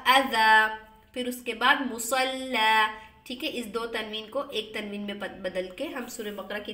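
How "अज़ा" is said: अज़ा, louder, with a long final ज़ा; pausal form. Shown as a demonstration. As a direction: answer crisply with अज़ा. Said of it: "रुक गए तो क्या होगा". (रुककर अज़ा, ज़ोर से)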